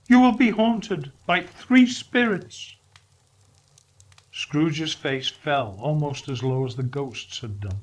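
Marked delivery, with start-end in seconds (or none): 0.1-2.8 high, louder mournful spirit; Marley's ghost lamenting. Acted. Marley's ghost lamenting his chains to Scrooge, high and mournful. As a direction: wail out regret.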